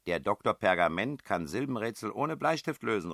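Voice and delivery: high, loud teasing call. A narrator's reading. Man heckling the two puzzlers from his room, loud call. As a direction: call it across to the next room. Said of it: "rief ich".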